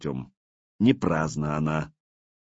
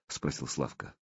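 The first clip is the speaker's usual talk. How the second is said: ordinary delivery, nothing marked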